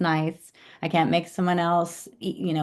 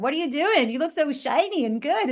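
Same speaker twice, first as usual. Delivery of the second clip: high, amazed friend voice; curious friends; 9 semitones higher than usual. Retold or imitated. Friends noticing the smoothies, asking in a high amazed voice. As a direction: gush like an impressed friend. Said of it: "They're like".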